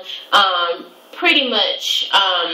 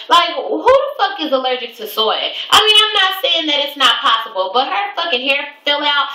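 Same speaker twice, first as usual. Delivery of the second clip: incredulous; 7 semitones higher than usual. Real, not acted. Incredulous rant about soy allergy.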